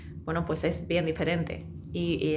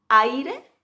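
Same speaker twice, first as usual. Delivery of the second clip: aire slowly with the vowels stretched apart; exaggerated aire; 6 semitones higher, 6 dB louder than usual. Shown as a demonstration. Teacher stretching aire for her students. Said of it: "fijaos qué exagerado".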